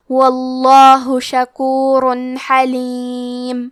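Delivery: آہستہ، کھنچی ہوئی تجوید والی تلاوت; ہر حرف کو مخرج سے ادا کرتے ہوئے آہستہ تلاوت; قرآن کی تلاوت. Shown as a demonstration. تلاوت کا انداز. (شاگردوں کو سنانے کے لیے آہستہ تجوید سے تلاوت)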